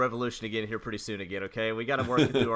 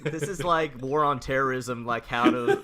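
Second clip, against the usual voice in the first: laughing; 7 dB louder than usual. Real, not acted. Laughing while joking.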